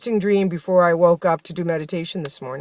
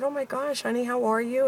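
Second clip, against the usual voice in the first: soft, high-pitched sympathy; retold tender concern; 5 semitones higher, 6 dB quieter than usual. Retelling how she greeted a crying little boy, tenderly concerned. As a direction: gently coo as if to an upset child.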